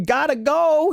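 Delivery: mock panicked shout knocking over rakes; yelling. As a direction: blurt it out while bolting.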